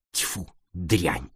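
low, quiet disgusted spit; disgusted spitting. A narrator's reading. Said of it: "сплюнула она".

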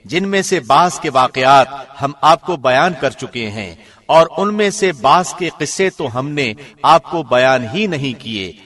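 normal talking voice

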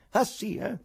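high, agitated retort; stunned. A narrator's reading. Ivan looking round like hunted prey, agitated. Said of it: "proferì Ivan sbalordito".

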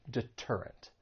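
deterrent with a crisp T, no flap; true T. Shown as a demonstration. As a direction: say de-TER-rent with a real T opening TER. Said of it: "say it as a true T".

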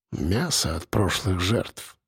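low, soft, slow mutter. A narrator's reading. Captor surprised by his own words, muttering. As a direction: mumble it low, as if to yourself. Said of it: "Пробормотал он".

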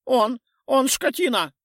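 high, lisping toothy creature; lisping wolf-toothed morph. A narrator's reading. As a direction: mumble through fangs, shrill and eager. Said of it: "прошамкал ... морф с волчьими зубами".

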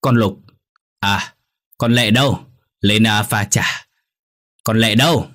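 lightly acted calling out. A narrator's reading. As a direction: call for the girl with some impatience. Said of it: "nên Lão kêu lên".

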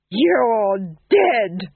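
loud crazed wailing threat. Acted. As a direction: scream it as you stab. Duke stabs the fool.

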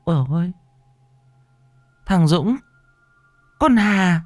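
put-on high wailing old woman's cry; frightened old woman calling. Old mother crying out for her children in the dark. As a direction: call out for the lost children in fear.

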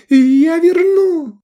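very high, loud, stretched-out squeal; panicked shriek. A narrator's reading. Akka with a blade at his neck, panicked shriek. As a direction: scream the promise in terrified falsetto. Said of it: "вылупил глаза Акка".

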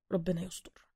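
worried aside. Acted. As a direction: say it under your breath, uneasy.

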